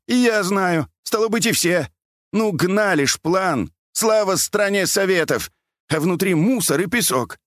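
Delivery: put-on high-pitched querulous old man; plaintive high old-man voice; whining old man. A narrator's reading. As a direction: complain in a high lamenting voice. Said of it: "продолжал ныть и причитать".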